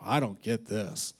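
low, soft, puzzled aside; baffled mutter. Retold or imitated. Pastor leaving the cow pasture, soft confusion. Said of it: "he's like".

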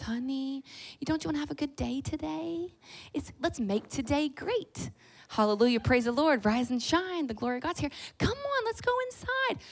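high, sweet, singsong coaxing; butter up a stubborn child at the school door; sickly sweet mom voice, climbing very high at the end; sugary coaxing mom. Retold or imitated.

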